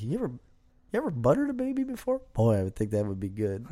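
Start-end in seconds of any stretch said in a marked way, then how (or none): 0.0-2.2 imitating Theo Von. Friend quoting the comedian's odd line in a high voice. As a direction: ham up the comedian's weird question. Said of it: "He's like".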